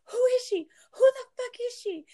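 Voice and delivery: high, loud mock-tearful outburst; imitated crying demand. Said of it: "crying, and she's like".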